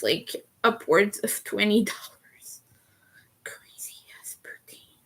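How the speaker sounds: breathy whisper fading to almost nothing. Real, not acted. Streamer muttering to chat about pricey poutine, whispered. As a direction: whisper the complaint under your breath.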